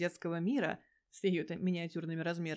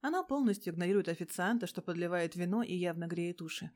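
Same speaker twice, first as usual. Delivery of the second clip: ordinary delivery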